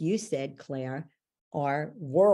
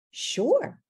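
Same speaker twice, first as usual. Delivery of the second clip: much higher, louder breezy sure; young mother agreeing; 14 semitones higher, 5 dB louder than usual. Retold or imitated. Young mother accepting coaching, much higher and louder. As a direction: toss off a bright easy yes.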